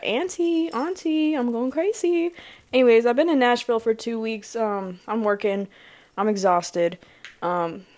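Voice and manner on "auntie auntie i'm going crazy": put-on high, pleading little-kid voice; voicing a goofy kid. Voicing the goofy little guy begging his auntie. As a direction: beg like a frazzled little nephew. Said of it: "He said".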